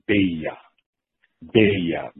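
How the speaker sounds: Beiya, name pronounced the Japanese way; Japanese pronunciation Beiya. Shown as a demonstration. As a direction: say Beiya with a long "bay" and open "ya". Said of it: "pronounce your name in Japanese as".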